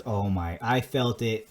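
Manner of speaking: ordinary delivery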